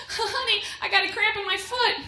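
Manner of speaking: put-on whiny boyfriend. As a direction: play a boyfriend fishing for pity. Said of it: "boyfriend comes up to you and goes".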